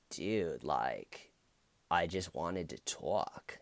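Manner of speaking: a hint of a Valley surfer dude; Valley surfer accent. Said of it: "the accent that he does".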